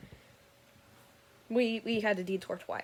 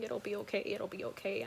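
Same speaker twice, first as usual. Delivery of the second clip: quiet repeated mantra; 8 dB quieter than usual. Retold or imitated. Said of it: "I'm just repeating".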